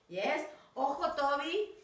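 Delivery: ordinary delivery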